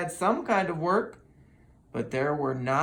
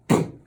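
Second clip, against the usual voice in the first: loud spoken boom; sudden crash boom; boom sound effect; 7 dB louder than usual. A narrator's reading. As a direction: bang it out. Crash in story.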